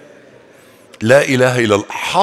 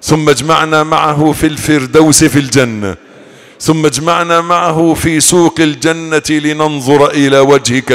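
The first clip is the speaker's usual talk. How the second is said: prayer; 6 dB louder than usual. Real, not acted. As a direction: draw each plea out in prayer. Closing supplication of a sermon.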